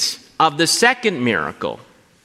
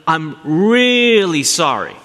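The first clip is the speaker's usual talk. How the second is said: slow, louder, drawn-out sorry with stress on really; emphatic apology; 3 semitones higher, 4 dB louder, slower than usual. Retold or imitated. Spouse in the doghouse apologising again, slow and emphatic. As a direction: plead it so they finally believe you. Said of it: "in your intonation of your voice".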